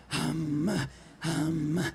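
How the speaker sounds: deep throat-sung "hum"; monster voice. Shown as a demonstration. Throat singer shows how the first person starts, monster voice. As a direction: drop each "hum" low into a monster voice. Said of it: "use your monster voice".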